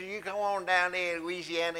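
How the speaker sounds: lightly put-on thick Louisiana Southern accent. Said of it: "Tom, what did he just say?".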